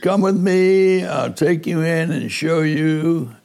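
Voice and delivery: high, bright imitated Cuban accent. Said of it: "he called me in his Cuban, uh, accent".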